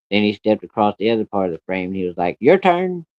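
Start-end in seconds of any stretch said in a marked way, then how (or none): 2.4-3.1 much higher-pitched "your turn"; quick high prompt, acting out the invitation; retold call. Dad beckons child to follow.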